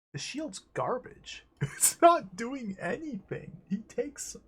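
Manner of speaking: laughing exasperation. Real, not acted. Laughing frustration at useless shield.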